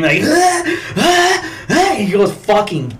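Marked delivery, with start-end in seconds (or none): 0.2-1.9 imitated high-pitched manic scream; short bursts of hammed-up screaming; Nicolas Cage. Actor's character after his wife's death, mock crazed screams. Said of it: "the famous Nicolas Cage scream".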